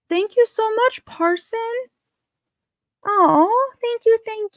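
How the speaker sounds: high, delighted squeaky thank-you; high squeal of thanks. Real, not acted.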